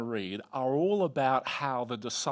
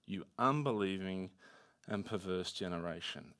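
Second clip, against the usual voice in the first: low, subdued reproach, softer at the end; grave rebuke; 7 semitones lower, 6 dB quieter than usual. Acted. Disciples hearing rebuke instead of affirmation, grave and hushed. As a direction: deliver the rebuke low and quietly. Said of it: "what do they hear?".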